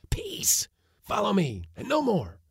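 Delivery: put-on high, eager old man orders; commanding old uncle. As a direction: hurry the crew, careful but keen.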